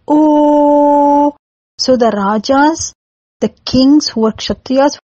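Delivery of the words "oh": long, higher surprised Oh; surprised shout. Shown as a demonstration. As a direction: cry out in astonishment, held. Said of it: "you give out a shout".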